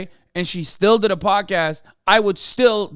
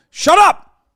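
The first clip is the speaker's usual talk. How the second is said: much higher and louder, a barked shut up; indignant shout; 12 semitones higher, 10 dB louder than usual. Real, not acted. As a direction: blast back at the correction. Mock outrage at being corrected.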